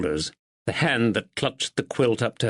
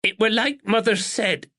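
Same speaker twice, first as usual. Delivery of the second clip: crackling with emotion; 11 semitones higher than usual. A narrator's reading. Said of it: "Her voice crackled with emotion".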